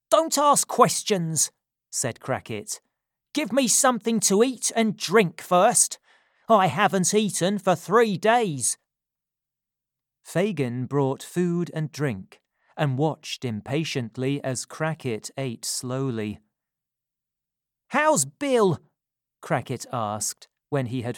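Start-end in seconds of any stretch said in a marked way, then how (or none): 0.1-8.8 louder, higher robber voice; worn out and curt; gruff robber. A narrator's reading. Crackit gruff and hungry. 17.9-18.8 higher, louder question; eager for news; anxious old man. A narrator's reading.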